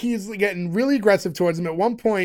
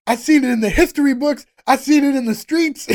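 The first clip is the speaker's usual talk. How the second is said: higher, mock-bragging kid voice; mock a cocky student bragging; imitating a kid; 6 semitones higher, 3 dB louder than usual. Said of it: "He's like".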